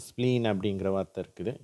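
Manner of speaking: normal talking voice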